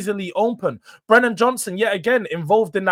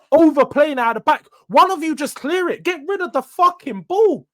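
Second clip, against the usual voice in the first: higher and louder, barking at the players; frustrated yelling; 8 semitones higher, 5 dB louder than usual. Real, not acted. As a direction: shout at the defenders to clear it. Fan yelling at defenders.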